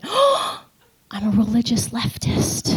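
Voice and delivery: sharp high gasp into a hushed confession; gasp, then admit it in a high, small voice; horrified realization. Retold or imitated. Speaker reliving her shock at being like the left, horrified realization. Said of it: "and I went".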